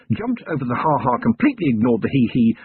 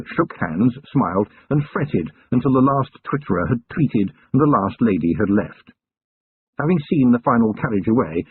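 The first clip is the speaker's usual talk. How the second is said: ordinary delivery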